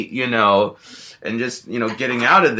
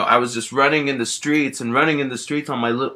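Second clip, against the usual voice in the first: usual speaking voice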